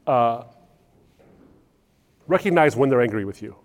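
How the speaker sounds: normal talking voice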